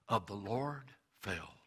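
usual speaking voice

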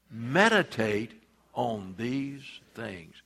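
measured scripture reading with pauses between phrases; slow group reading. Shown as a demonstration. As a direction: read slowly so others can read along. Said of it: "We're gonna read it slow".

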